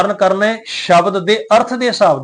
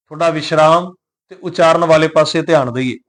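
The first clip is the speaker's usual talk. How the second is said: ordinary talk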